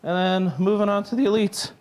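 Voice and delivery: out of breath, pushing through; breathless, gasping between phrases; running out of air. Real, not acted. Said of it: "I'm running out of breath".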